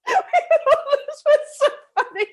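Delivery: high, loud, through helpless laughter; real giggling as the words come out; laughing. Real, not acted. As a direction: talk while cracking up. Laughing at her own retold memory.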